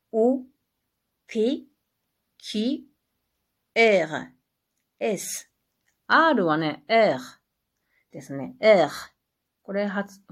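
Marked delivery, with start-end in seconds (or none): 6.1-8.9 "R" with a throaty French uvular R. Shown as a demonstration. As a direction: growl the R in the back of the throat. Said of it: "フランスの独特な発音ですね". (フランス語の「R」を喉の奥で鳴らす)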